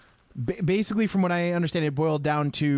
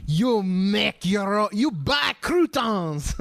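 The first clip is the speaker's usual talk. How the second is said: put-on slow, louder French accent; 6 semitones higher, 3 dB louder, much slower than usual. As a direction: scoff it in a thick French accent. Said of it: "in his French accent".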